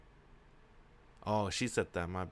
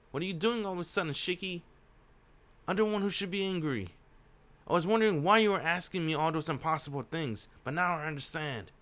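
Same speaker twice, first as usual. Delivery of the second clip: higher-pitched, put-out voice; miffed heroine, voice raised in pitch; indignant woman character; 7 semitones higher, faster than usual. Acted. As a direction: pitch it up and scold him.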